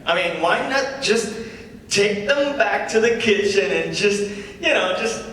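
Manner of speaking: lightly voiced comedy-club patter; stand-up comic. [name] opening his set to the crowd, casual comic patter. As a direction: work the crowd with an easy opener.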